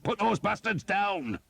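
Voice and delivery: high, loud battlefield bellow; shouted order. A narrator's reading. Commander ordering archers to shoot, a loud high bellow. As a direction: yell the order across the river. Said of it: "Skeet shouted".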